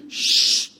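unvoiced hush; a sharp, breathy shush for silence. Imita a alguien pidiendo silencio. (chistido susurrado para callar)

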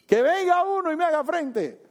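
high, loud taunting yell across the valley; mocking shout. Goliath taunting the Israelites with a high loud yell. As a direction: bellow it like a giant mocking the enemy. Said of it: "Goliat gritándole".

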